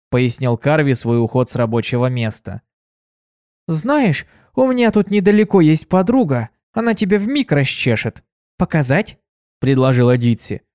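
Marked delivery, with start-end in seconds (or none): 3.7-9.2 lightly voiced cheerful young mare, higher; chirpy helpful girl offering. Ditsy offers to take him to her friend, bright and higher.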